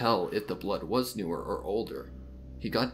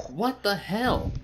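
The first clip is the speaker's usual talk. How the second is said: high, slow, stunned outcry; shocked disbelief; 12 semitones higher, 7 dB louder, slower than usual. Acted. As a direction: draw it out in shock. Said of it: "asked Cadwell".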